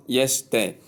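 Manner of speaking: "yesterday" minus its middle, YES-day; shortened mispronunciation. Shown as a demonstration. Teacher warning learners off it, shortened mispronunciation. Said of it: "Please, it's not".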